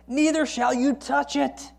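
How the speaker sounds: high, slow, drawn-out "Neither shall you touch it"; emphatic. Acted. Woman adding to God's command, emphatic on "touch it". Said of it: "it's emphatic".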